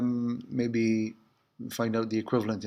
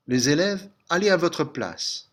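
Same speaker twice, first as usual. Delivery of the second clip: teacher calling the class; 5 semitones higher, 3 dB louder than usual. Shown as a demonstration. Teacher calls class to seats.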